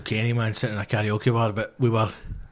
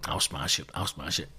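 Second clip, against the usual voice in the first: low confident mutter; 9 semitones lower, 10 dB quieter than usual. Retold or imitated. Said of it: "second act's going".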